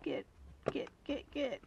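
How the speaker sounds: ordinary talk, nothing marked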